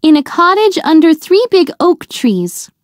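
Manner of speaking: put-on high, bright little girl voice; little girl, guileless and cheerful; young girl answering. A narrator's reading. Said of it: "said the girl".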